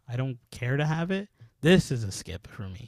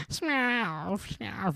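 high, soft cartoon cat catchphrase; chubby cat voice; 8 semitones higher, 4 dB quieter than usual. Describing a chubby cat from the cartoon, imitated high-pitched cat voice. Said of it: "a chubby cat that goes".

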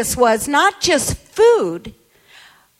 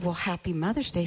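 much softer, quick and gentle; soft tender greeting; 8 semitones lower, 13 dB quieter, faster than usual. Retold or imitated. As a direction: say it quietly to one mother. Same greeting, intimate moment.

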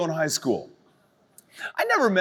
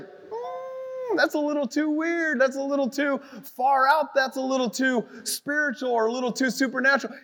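mocking skeptics; 6 semitones higher than usual. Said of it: "everybody's like".

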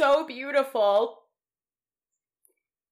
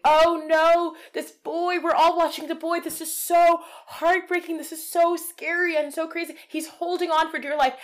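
higher, overwrought news anchor voice; dramatic newscaster; 7 semitones higher, 3 dB louder than usual. Retold or imitated. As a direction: play a breathless TV reporter. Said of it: "have the newscaster be like".

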